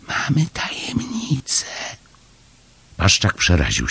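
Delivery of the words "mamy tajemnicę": high, secretive little whisper. A narrator's reading. Chomek sharing a secret with uncle through the gate, high whisper. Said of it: "szepnął".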